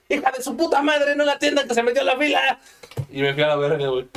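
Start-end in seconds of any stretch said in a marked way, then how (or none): 0.1-2.6 high-pitched retold yell at the woman cutting in line; retold shout. Narrator yelling at the lady who cut the line, mock furious. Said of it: "le grité".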